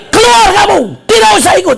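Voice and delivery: loud, high acted order to leave; angry dismissal. Teacher sending late student out, angrily.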